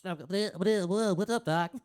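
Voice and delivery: "What's up, Doc" with a cartoon stammer on the first word; put-on stuttering lead-in to "What's up, Doc". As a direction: stammer repeatedly before getting out "What's up, Doc". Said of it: "I got a stutter".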